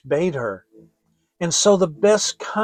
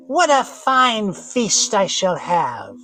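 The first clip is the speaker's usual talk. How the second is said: slow, greedy witch gloat; 5 semitones higher, 4 dB louder, slower than usual. Acted. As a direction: savour every word like a hungry witch. Witch gloats over sleeping children.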